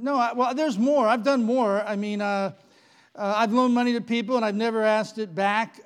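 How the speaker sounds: loud, rising panicked listing; frantic pleading. Acted. Man desperately pleads his record to Saint Peter, rising panic. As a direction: pile on deeds in growing panic.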